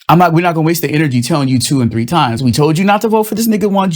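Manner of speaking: ordinary talk, nothing marked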